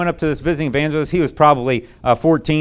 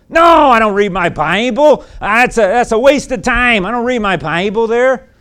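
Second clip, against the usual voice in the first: put-on bitter teenager; 7 semitones higher, 7 dB louder than usual. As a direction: voice the angry teen, louder and higher. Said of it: "Do you read your Bible?".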